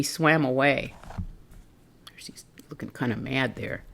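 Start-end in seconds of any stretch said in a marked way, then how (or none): 2.2-3.8 whisper. Real, not acted. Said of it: "aside whisper".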